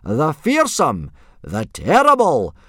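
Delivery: slow, high, booming introduction; forced enthusiastic yell. A narrator's reading. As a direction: stretch each title out, yelling. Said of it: "trying to put a bit of enthusiasm into his yelling".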